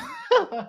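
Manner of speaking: short high-pitched giggle; a helpless little laugh way up high; high embarrassed giggle; squeaky laugh. Real, not acted. Pleads, viewers keep gifting.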